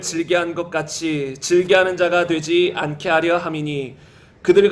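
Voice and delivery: low, even, measured read-aloud; scripture reading. Real, not acted. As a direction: read the verses in a low, steady chant-like cadence. Reads 1 Corinthians passage aloud.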